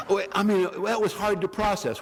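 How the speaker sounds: ordinary delivery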